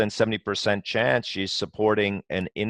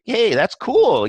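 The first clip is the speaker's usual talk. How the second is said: much higher, louder and slow, breezy and light; breezy casual reply; 13 semitones higher, 5 dB louder, slower than usual. Retold or imitated. Chairman to a nervous customer, breezy much higher voice. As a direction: brush it off like it's no big deal. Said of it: "I'm just kinda like".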